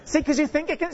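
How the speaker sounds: ordinary delivery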